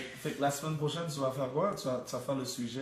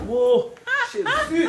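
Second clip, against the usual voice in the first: pained exclamation; 13 dB louder, much slower than usual. Real, not acted. Cramp pain at sign-off.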